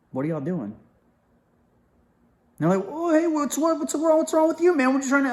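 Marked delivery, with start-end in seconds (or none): 0.1-0.7 ice-cold grilling. Retold or imitated. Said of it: "just ice cold grilling them". 2.9-5.3 high-pitched imitation of flustered guys; mocked protesting guys. Mimics the guys' startled protest.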